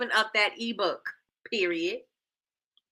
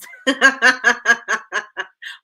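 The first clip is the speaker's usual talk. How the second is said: laugh; 7 dB louder than usual. Real, not acted. Laughs at own joke.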